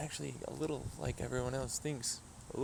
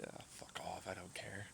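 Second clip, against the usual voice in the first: muttered under the breath; whispered brush-off; 3 semitones lower, 11 dB quieter than usual. Real, not acted. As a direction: toss it off in a soft whisper.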